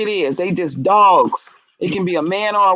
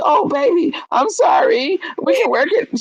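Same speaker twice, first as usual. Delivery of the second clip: mock pleading wife; 8 semitones higher than usual. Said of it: "then she was like".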